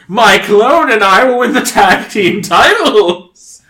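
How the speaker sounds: slow, high, loud proclamation; grand boast. Acted. Wrestler introduces identical brother as clone, boasting grandly. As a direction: declare it like a mad-scientist wrestler.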